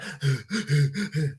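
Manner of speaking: quiet, strained gasp for air under a mask; laboured gasping. Retold or imitated. Showing how laboured breath felt in the loft, a strained gasp. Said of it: "I can feel myself going".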